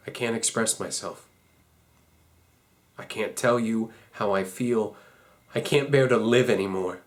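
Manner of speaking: low, subdued despair; quiet despair. Acted. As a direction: confess it with the spirit drained out. Reads a despairing farewell letter.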